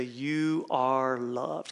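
slow, measured delivery; slow call-out. Shown as a demonstration. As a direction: draw each word out for the congregation to repeat. Models line for congregation chant.

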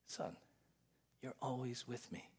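soft, gracious father; gently reassuring son; tender. Retold or imitated. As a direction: speak softly with affection. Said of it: "Tender, affectionate, gracious".

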